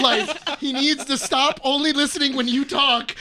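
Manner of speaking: loud, exasperated plea; so frustrated. Retold or imitated. Buddy complaining Brian only listens to friend, frustrated outburst. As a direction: let the held-back complaint burst out. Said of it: "he was so frustrated".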